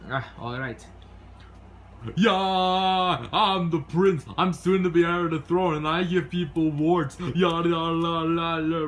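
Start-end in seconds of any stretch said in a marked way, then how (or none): 2.1-8.9 hammed-up jester act. Fool performs his act.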